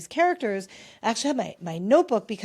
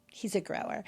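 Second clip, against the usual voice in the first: "He's a grower." repeated much softer, as a knowing aside; quiet aside; 9 dB quieter than usual. Real, not acted. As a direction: drop the repeat of "He's a grower." to a sly undertone. Repeats phrase for comic effect.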